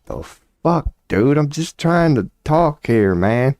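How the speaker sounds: high, exasperated mock protest; baffled debate opponent. Opponent steamrolled by Shapiro protests, high exasperated voice. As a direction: squeak it out, overwhelmed and fed up. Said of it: "And you're like".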